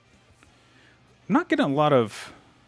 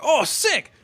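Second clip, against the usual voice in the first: higher, louder burst of delight; excited reaction; 7 dB louder than usual. Retold or imitated. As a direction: exclaim it, thrilled by good news. Retells own delighted reaction.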